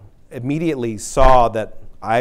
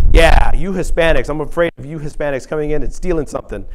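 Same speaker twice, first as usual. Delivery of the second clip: louder, fast mock-teasing jab; quoting a cantankerous coworker's joke, louder and fast; joking colleague; 4 semitones higher, 5 dB louder than usual. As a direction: play the un-PC colleague ribbing a friend. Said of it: "He says".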